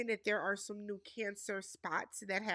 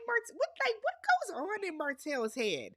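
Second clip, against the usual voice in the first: exasperated disbelief; 9 semitones higher than usual. Real, not acted. Vlogger baffled by what Martell says, high disbelieving squeak. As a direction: pitch jumps way up in disbelief.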